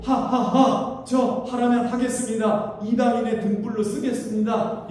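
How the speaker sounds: Paul kneeling, giving himself up out loud; Paul's surrender. Retold or imitated. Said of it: "사도 바울은 ... 무릎 꿇고". (바울의 외침)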